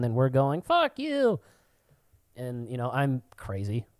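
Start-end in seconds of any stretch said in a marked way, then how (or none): 0.6-1.4 high mock yell of a fan; retold yell. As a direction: hurl it across the stands. Crowds yelling at each other.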